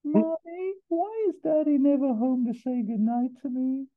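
put-on squeaky-high little American boy; annoying American accent. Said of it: "a very thick, annoying American accent".

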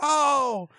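high, loud mock cry of pain; beaten teacher's cry. Acting out the science teacher's beating, pained cry. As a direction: yelp as if struck.

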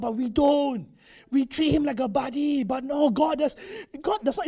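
ordinary delivery

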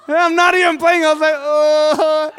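a gasping, stunned "oh" of delight; overwhelmed excitement. Real, not acted. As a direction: let it burst out, moved and amazed. Dad overwhelmed by son's words.

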